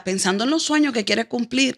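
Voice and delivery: normal talking voice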